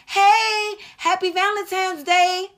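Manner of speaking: high, cheery imitation of the boyfriend; boyfriend's greeting. Her boyfriend calls her, happily imitated and pitched high. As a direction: chirp it like a happy boyfriend calling. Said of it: "The boo, my significant other".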